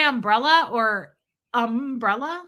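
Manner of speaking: umbrella normally, then UM-brella with the first syllable stretched; second umbrella split, long stress on UM; contrasting umbrella stress. Shown as a demonstration. Host puzzled by a viewer's question, umbrella against drawn-out UM-brella. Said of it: "Who the fuck says umbrella?".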